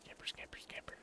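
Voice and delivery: sneaky whispered scamper. Pretend. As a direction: breathe it out like sneaking.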